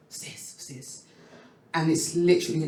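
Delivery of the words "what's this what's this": barely voiced, curious murmur; whispered what's this. Alone at her screen, a curious whisper to herself. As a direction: breathe the words to herself. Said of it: "I click on it".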